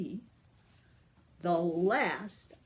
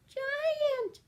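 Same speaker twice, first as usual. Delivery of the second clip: much higher, surprised little gasp; gasping fairy. Acted. The fairy is surprised to see the giant, high gasp. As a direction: gasp the word like a startled fairy. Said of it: "she gasped".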